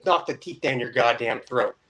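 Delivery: usual speaking voice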